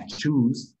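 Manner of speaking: "choose" slow with a long oo; English choose. Shown as a demonstration. Teacher comparing "choose" to lose, slowly. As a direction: stretch "choose" deliberately. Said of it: "Igual que lose".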